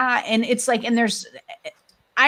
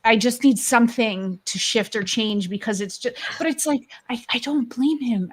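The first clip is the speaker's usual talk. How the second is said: usual speaking voice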